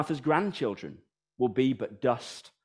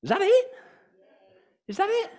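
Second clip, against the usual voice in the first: incredulous; faster than usual. Real, not acted. Reacting to eat drink be merry.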